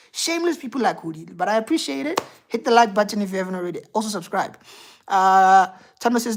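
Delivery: normal talking voice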